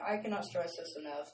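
ordinary talk, nothing marked

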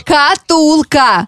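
very slow, over-articulated; slow exaggerated mouthing. Shown as a demonstration. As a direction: stretch every syllable for lip-reading. Mouthing the word for lip-reading.

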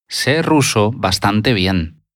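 ordinary delivery

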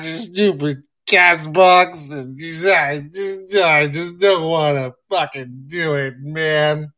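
grumbling. Acted. Grumpy host behind scenes.

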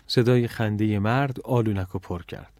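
plain reading voice

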